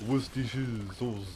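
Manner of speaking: put-on laughing Orlesian bard accent. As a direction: say woos-tee-shee sauce like a light Orlesian bard. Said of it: "He's used to saying words like".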